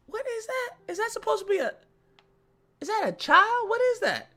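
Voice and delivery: hammed-up high bewildered voice; high confused. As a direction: squeak out the question in disbelief. Mock confused high quote.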